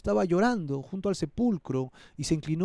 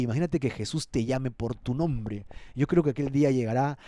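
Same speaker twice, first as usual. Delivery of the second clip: ordinary talk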